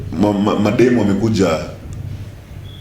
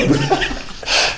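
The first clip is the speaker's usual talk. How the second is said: breathy laugh; 3 semitones higher, 4 dB quieter than usual. Real, not acted. Laughs after "I get bored.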